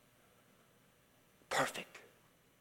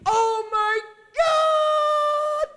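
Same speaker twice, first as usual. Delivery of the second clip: full-force high, drawn-out shriek; retold scream; 8 dB louder, much slower than usual. Preacher re-enacts woman overwhelmed by the miracle, long piercing scream. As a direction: scream it slowly at the very top of the voice.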